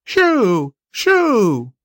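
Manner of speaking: high-pitched bird cry, drawn out and falling; two high, falling bird calls; croaking bird. A narrator's reading. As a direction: cry out like an offended magpie flying off.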